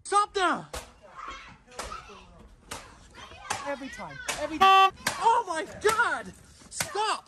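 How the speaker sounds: high frantic shouts; yelling. Real, not acted. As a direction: yell to stop them. Kids smashing laptop, parent yelling.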